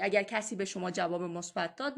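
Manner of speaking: usual speaking voice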